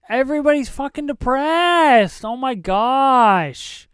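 high, loud wail of dismay; loud drawn-out lament; exasperated outburst. Real, not acted. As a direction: cry out in exasperation, dragging the last words. Exasperated outburst at the sad film.

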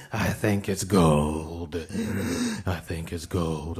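slow, in a put-on voice; put-on voice quip. Cohost mocking the gold-looking teeth, slow put-on voice. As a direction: repeat the joke as a character. Repeated joke in new voice.

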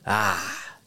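put-on soft, low old-timer voice; imitated old man. Old guy at the gas station corrected, imitated old man. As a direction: imitate an old stranger slowly catching on. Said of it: "a mad old guy came up".

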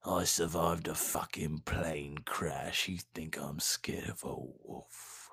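slow hoarse whispered threat; slow threatening whisper to a wolf; menacing whisper. Pretend. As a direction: whisper it like a hardened survivor. Tough-guy wolf-fighter impression.

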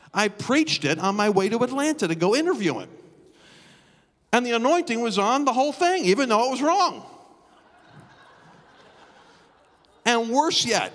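high, loud preaching climax; building preacher shout. Real, not acted. Preacher telling his congregation, loud rising climax. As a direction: rise in pitch and volume as the story peaks.

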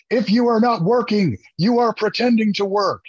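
a hint of a drill instructor lecturing the troops; R. Lee Ermey. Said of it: "I go full R. Lee Ermey".

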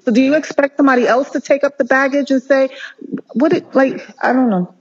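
emotional outburst over colleagues walking away; getting emotional. Real, not acted. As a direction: voice lifting as she fights back tears. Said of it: "I'm getting emotional".

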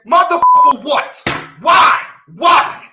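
loud, exasperated repeated why; real outrage shouted again and again; furious shouts. Real, not acted. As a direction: blow up at the whole idea. Exploding at Hall of Fame signing.

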